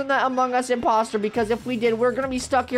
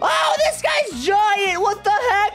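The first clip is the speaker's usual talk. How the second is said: very high, drawn-out shrieks; panicked screaming; 8 semitones higher, much slower than usual. Pretend. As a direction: scream in hammed-up terror at a giant. Giant imposter appears in game.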